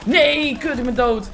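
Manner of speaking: frustrated gamer's "no"; dismayed yell. Real, not acted. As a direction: protest loudly at a sudden death. Player's character gets killed.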